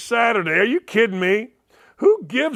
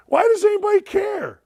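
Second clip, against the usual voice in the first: incredulous squeak; 12 semitones higher than usual. Real, not acted. Host scoffs at critics.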